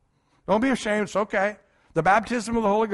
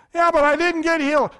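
higher, louder plaintive protest; whiny objection; 8 semitones higher, 6 dB louder than usual. Retold or imitated. A sick man protesting to Wigglesworth, higher and louder. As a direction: protest like a sick man who wasn't healed.

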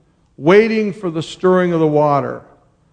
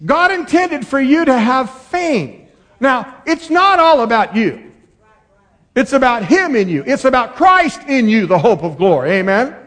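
full-force preaching; 8 semitones higher, 5 dB louder than usual. Acted.

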